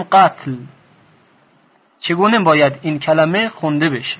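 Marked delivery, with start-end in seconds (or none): 0.0-0.6 قتل in one syllable, final consonants unvowelled; read as "qatl". Shown as a demonstration. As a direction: say قتل as qatl, no vowel after t or l. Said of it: "یا اینکه بخونیم".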